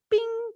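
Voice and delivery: high, sustained "bing" like a phone alert; imitated notification chime. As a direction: mimic a phone's message ding on a high note.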